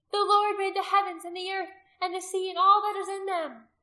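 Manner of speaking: high, loud proclamation; crying out. A narrator's reading. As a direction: cry scripture over the chant. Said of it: "Rizpa cried out".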